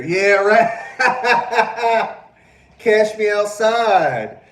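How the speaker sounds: high, loud laughter through the words; laughing hard. Real, not acted. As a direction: crack up as you say it. Cracking up at a meme.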